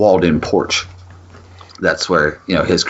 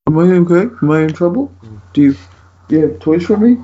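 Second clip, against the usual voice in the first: high, halting pet voice; guilty dog voice; 11 semitones higher, faster than usual. Pretend. Owner voices dog fearing trouble, high put-on guilty voice. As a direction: ask timidly, as a dog hoping for forgiveness.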